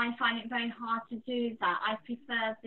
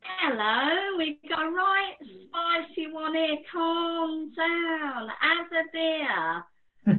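higher, loud Cockney pub bloke; 7 semitones higher, 6 dB louder, much slower than usual. Acted. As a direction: play a cheeky Cockney lad in the pub. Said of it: "I can do a Cockney voice".

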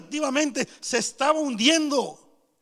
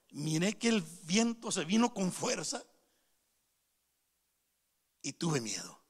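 low, hushed, halting answer; subdued confession; 6 semitones lower, 7 dB quieter than usual. Acted. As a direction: admit fear softly, sinking almost to nothing. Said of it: "Pedro dio la respuesta". (confesión en voz baja y pausada)

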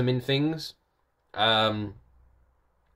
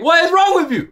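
much higher and louder, yelling at the wife in disbelief; retold frantic yell; 10 dB louder than usual. Husband yelling at wife who finally came home, loud disbelief. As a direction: shout it out, half-laughing at the memory. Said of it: "I was like".

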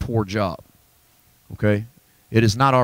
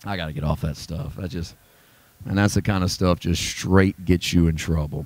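usual speaking voice

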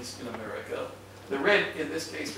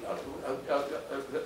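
ordinary delivery

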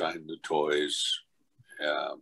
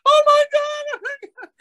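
very high-pitched mock shriek; imitated horrified mom; 9 dB louder than usual. Mom opens box with monster cat, mock high shriek. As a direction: squeal in hammed-up shock.

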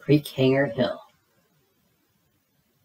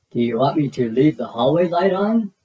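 gentle dad voice; 3 semitones higher than usual. Acted. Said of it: "asked Dad".

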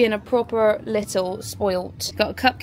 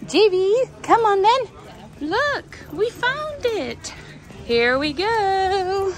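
high dog-call; 9 semitones higher, slower than usual. Real, not acted. Calling dog, high sing-song.

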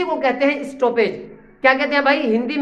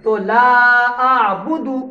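slow recitation; much slower than usual. Shown as a demonstration. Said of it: "जल्दी पढ़ रहे हैं".

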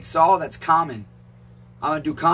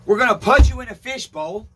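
ordinary delivery

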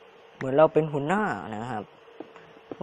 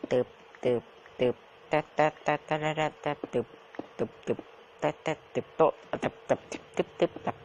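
breathy tup and tap noises, half voiceless; mouth sound effects; 2 semitones higher than usual. Pretend. Gamer mouthing pickaxe taps, half voiceless. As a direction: tap out the digging with little mouth clicks.

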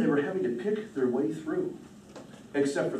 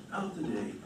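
softer echo; 10 dB quieter, faster than usual. A narrator's reading. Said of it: "repeated phrase".

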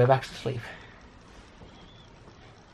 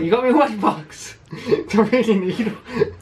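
high, loud delighted gasp; louder, higher, laughing joy; excited quote; 7 semitones higher, 5 dB louder, slower than usual. Retold or imitated. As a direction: retell the thrill with a laugh. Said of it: "and I was like".

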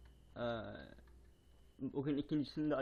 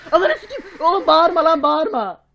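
very loud high yelling, slowed; terrified yell; 21 dB louder than usual. Real, not acted. Yelling in panic at the shrieking monster. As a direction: yell back at the screaming monster.

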